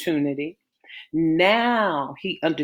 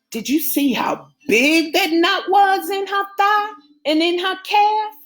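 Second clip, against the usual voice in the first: much higher female friend voice, worried; Tracy; 14 semitones higher, 5 dB louder than usual. Acted. Tracy tells Keisha about the knot, high and worried. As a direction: play Tracy, aghast at the knot.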